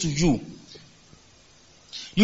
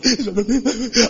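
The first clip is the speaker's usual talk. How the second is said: amused laugh; 3 semitones higher than usual. Real, not acted. Laughing at mock suicidal quote.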